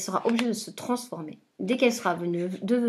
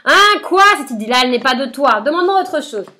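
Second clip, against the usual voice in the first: much higher, very loud furious witch shriek; cry of rage; 5 semitones higher, 12 dB louder than usual. Acted. Witch shrieking in rage at the boy's demand, very loud. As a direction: scream out her fury. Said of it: "Elle pousse un cri de rage".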